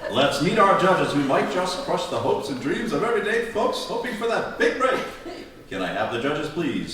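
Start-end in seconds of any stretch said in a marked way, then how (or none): 0.1-5.0 big, brassy game show host intro; booming announcer opening the show. Acted. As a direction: sell it like a cheesy talent show host. Host opens talent show.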